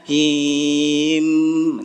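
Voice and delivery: "him" sung long with the m merged in; idgham demo. Shown as a demonstration. Teacher showing how "him" merges, drawn out and sung. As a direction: hold "him" as a smooth nasal merge, not pressed. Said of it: "jadi mimnya diidghamkan".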